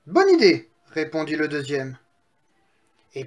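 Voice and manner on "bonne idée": high, loud, delighted agreement; eager piglet. Acted. Second little pig agrees to his brother's idea, eager. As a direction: pipe up with eager approval.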